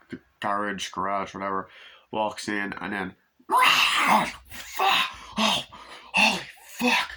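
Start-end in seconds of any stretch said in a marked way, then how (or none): 3.5-7.1 very high, loud swearing in shock; acted-out yelp of fright; mock startled yell. Man walking into the garage, high loud mock startled yell.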